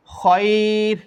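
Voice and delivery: খয়র with the অয় drawn out one alif; stretched vowel. Shown as a demonstration. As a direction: hold the অয় in খয়র long before the র. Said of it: "এরকম এক আলিফ টেনে".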